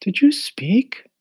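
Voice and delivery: high breathless whisper of amazement; whispered wonder. Acted. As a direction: whisper it, astonished. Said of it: "asked the girl in wonder".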